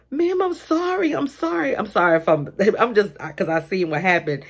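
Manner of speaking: high, pleading panicked sorry; frantic apology. Retold or imitated. Teacher Sally apologizing to the angry mom, panicked. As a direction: beg forgiveness in a rush. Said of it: "she was like".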